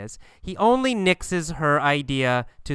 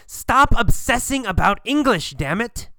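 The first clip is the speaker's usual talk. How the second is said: higher, louder exasperated scolding; reprimanding; 8 semitones higher, 5 dB louder than usual. Acted. Gabriel reprimands Kathy for obsessing about English, exasperated scolding. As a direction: snap it out as a sharp telling-off. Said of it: "He always reprimands Kathy".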